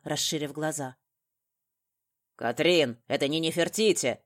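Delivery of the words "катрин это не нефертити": loud, brusque shout; raised voice, sharp protest; barked objection. A narrator's reading. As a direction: bark it out at a colleague. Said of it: "гаркнул Макаров".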